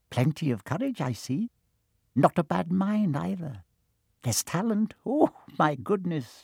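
small hat voice. A narrator's reading. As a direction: weigh the boy up kindly. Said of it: "a small voice in his ear".

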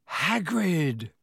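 relieved, cracking little croak; croaked in relief. Acted. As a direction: croak his name out, relieved. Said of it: "Harry croaked in relief".

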